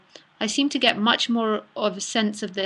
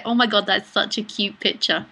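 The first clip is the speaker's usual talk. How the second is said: ordinary talk, nothing marked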